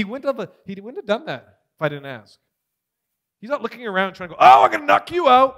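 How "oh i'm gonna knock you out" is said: loud, higher bully threat; acted-out bullying shout; mock threat. Mimics God as a threatening bully.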